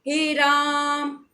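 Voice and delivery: राम stretched to three times its length, as if calling out; drawn-out call. Shown as a demonstration. Teacher calling out हे राम to show a prolonged vowel. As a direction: call हे राम with the आ of राम held long. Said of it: "तीन गुना समय लिया जाता है".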